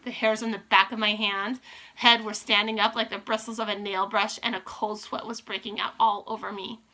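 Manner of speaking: ordinary delivery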